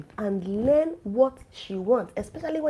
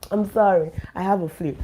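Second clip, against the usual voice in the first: usual speaking voice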